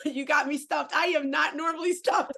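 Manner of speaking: laughing it off. Real, not acted. Host overwhelmed, laughing it off.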